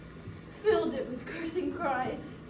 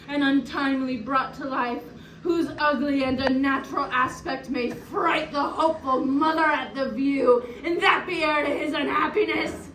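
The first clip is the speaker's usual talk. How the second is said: loud, tearful fury; anguished, rising denunciation; bitter cursing; 5 dB louder than usual. Acted. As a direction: hurl the curse, building into tears. Widow curses killer's child and wife.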